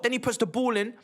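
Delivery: ordinary talk, nothing marked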